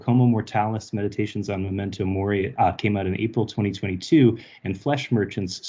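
normal talking voice

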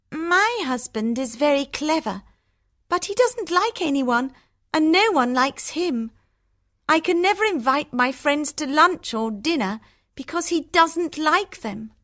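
a sister grumbling about her clever husband; complaining sister. Acted. Narrator voices Hortensia.

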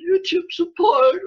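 high, slow, whiny mock sob; mock whimper. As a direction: whimper it like a sulking child. Mocking YouTube's reply.

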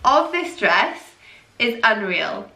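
ordinary delivery

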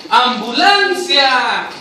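high cry of discovery settling into a drawn-out call; demonstrated shock. Shown as a demonstration. Director shows policeman calling for an ambulance, hammed-up shock. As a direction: cry out, then call slowly for help.

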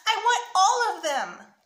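slow, high, loud tantrum; loud, high, drawn-out child's demand; screeched demand. A narrator's reading. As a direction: hammer it out like a screeching kid. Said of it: "Sammy screeched".

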